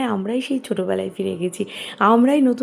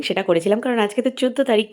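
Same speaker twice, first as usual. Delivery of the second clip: ordinary talk, nothing marked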